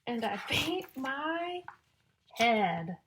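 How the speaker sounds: loud, slow, sing-song storybook reading; reading to children. Acted. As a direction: build suspense before each rhyme. Reading rhyming picture book to children.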